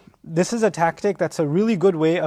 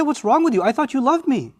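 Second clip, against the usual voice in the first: guilt-tripping; 7 semitones higher than usual. Acted. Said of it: "They're gonna say".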